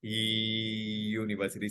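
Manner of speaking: he studies in a university, spoken slowly and softly; slow, sung university at the end; slow model sentence. Shown as a demonstration. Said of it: "तो इसलिए अ आया".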